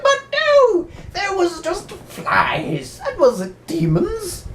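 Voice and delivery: very high, slow mock dismissal; dismissive church official. Official dismisses the priest's fears, high slow mock voice. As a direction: brush him off in a squeaky voice. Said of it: "the other guy's like".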